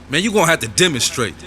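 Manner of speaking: high-pitched hothead egging him on; insistent urging. Acted. Inner voice demands that he act, high insistent urging. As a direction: push the demand high, falling at the end.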